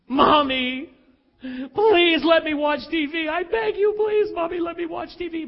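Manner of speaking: a daughter pleading with her mom for TV; begging child. Retold or imitated.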